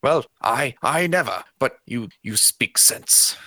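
spluttering, then fading off; flustered noble. Acted. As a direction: bluster then back down. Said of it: "straightens out his tunic, and says".

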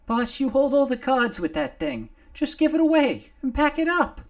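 pleading little Nomar. Acted. Nomar begging his old friend, high and loud. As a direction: beg the boss to let the safe go. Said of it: "Nomar pleaded".

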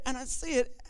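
usual speaking voice

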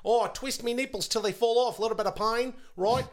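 broad, hammed-up Australian; silly Australian bloke patter; mock Australian accent. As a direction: lay on a caricature Aussie accent. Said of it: "Australians up here, you know".

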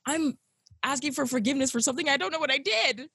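high, baffled mock whine; exasperated self quote. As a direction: play her younger self, lost and exasperated. Retelling her own exasperated reply.

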